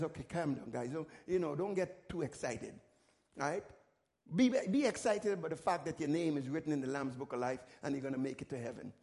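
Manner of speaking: low, soft, settling voice; Jesus quieting his excited disciples; calming Jesus. Retold or imitated. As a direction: gently talk the excited ones down.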